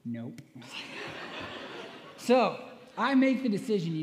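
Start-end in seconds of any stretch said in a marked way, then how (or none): 0.1-0.4 soft, low, a lightly voiced curt girlfriend; a flat little brush-off; curt deadpan no. 0.5-2.1 a long unvoiced laugh; a soft airy chuckle; breathy laugh. Real, not acted. Laughs at the story.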